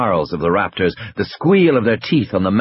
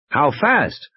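higher-pitched pressing question; lightly urgent "how fast?"; 6 semitones higher than usual. Acted. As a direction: ask it as pressing for an answer. Grant questions Muldoon over the radio.

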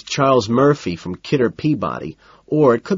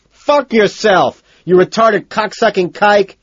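high, loud, drawn-out yell; furious scream; 10 semitones higher, 6 dB louder, slower than usual. A narrator's reading. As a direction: scream abuse at the manager. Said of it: "I get up and scream".

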